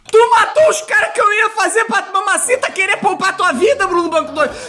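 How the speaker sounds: high furious yelling rant; angry shouting. Real, not acted. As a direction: scold him at full volume. Furious at pet killing mobs. (gritando com raiva)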